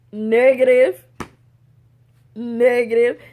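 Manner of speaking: loud, separate, firm denial; emphatic refusal. Real, not acted. Refusing the idea of being pregnant, emphatic. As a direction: punch it out with full volume.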